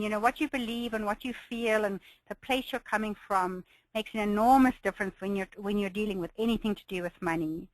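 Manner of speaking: ordinary talk, nothing marked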